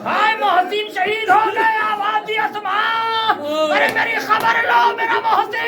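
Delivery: high, wailing cry at full force; lamenting preaching. Acted. Reciter wails the tragedy. (ऊँची आवाज़ में मातमी पुकार)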